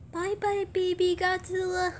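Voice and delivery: high, soft sing-song goodbye; high little send-off voice; playful bye-bye. Pretend. Waving off a toy.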